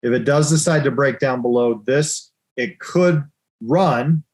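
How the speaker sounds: ordinary talk, nothing marked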